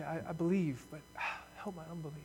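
fading to a breathless whisper; broken confession. Acted. Believer confessing doubt to God, faltering whisper. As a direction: let it crumble into a whisper. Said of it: "Where you say".

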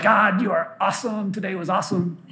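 softly voiced, mildly blissful prayer; contented bedtime prayer. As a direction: murmur thanks to God from the pillow. Contented bedtime prayer on easy days.

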